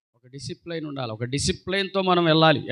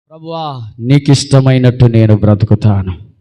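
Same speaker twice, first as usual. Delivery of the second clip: loud low vow; 7 semitones lower, 12 dB louder, slower than usual. Acted. Preacher voicing the believer's vow to God, loud and low.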